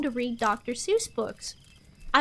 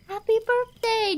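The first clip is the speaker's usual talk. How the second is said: excited; 9 semitones higher than usual. Real, not acted. Reacting to viewer's birthday.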